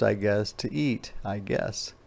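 ordinary delivery

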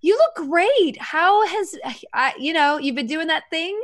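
high-pitched gushing greeting, badly faked; faking recognition. Greeting someone whose name is forgotten, overdone friendly gush. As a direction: gush as if you remember them. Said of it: "don't recognize anyone".

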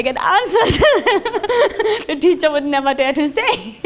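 amused, giggling through it; laughing while talking. Real, not acted. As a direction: voice bubbling with laughter.

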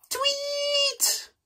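high chirpy bird tweet. Pretend.

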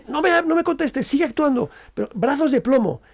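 teacher insisting from offstage, voice pitched high; acting teacher. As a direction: press the actor to keep going. Teacher shouting direction from offstage.

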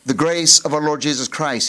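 ordinary talk, nothing marked

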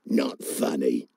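very low, sour frog voice; unamused frog. A narrator's reading. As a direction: dismiss it low, like a grumpy frog. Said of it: "said Tiddalik".

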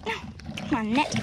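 ordinary talk

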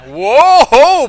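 big, loud, high whoa; hammed-up scandalised exclamation; mock shock. Congregation learns the pastor speeds, mock shocked.

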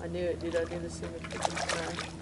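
ordinary talk

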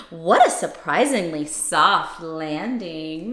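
usual speaking voice

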